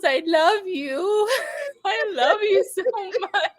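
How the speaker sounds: slow, tearful laughing words; laughing and crying at once; teary laughing love. Real, not acted. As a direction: tell the dog you love it through tears of laughter.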